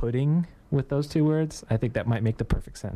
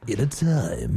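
imitating a trailer announcer, lower; movie guy voice; 4 semitones lower, slower than usual. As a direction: drop into a mock trailer intro. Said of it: "I love how he always starts out".

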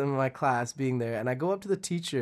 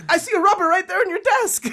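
put-on much higher, laughing child insisting; high kid voice; 7 dB louder than usual.

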